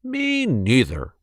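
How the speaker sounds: slow, a hint of a dry dad; understated father voice; lightly voiced father. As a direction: agree like a laconic father. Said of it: "said Wilson's father".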